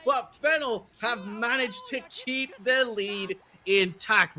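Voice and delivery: high, fast-building excited commentary; live play-by-play. Acted. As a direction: hype up the teamfight.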